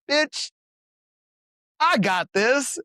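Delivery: cocky retort. Retold or imitated. Ego speaking in retold story.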